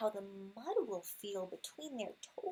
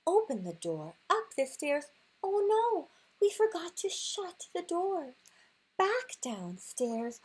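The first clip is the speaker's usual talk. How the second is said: louder, hurried and alarmed; frantic rush; 7 semitones higher, 7 dB louder than usual. Acted. Reading the dash home to her son, loud and alarmed. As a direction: bustle through it in mock panic.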